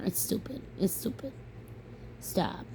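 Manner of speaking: soft, breathy muttered scorn; muttered dismissal. Real, not acted. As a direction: mutter it under your breath. Dismissing the rhetoric, trailing quiet.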